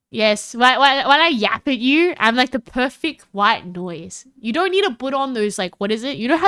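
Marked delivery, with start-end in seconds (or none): none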